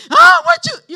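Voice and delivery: sudden high, loud shout; old self's outburst. Retold or imitated. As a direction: blow up at them the old way. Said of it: "I'd have had to get all".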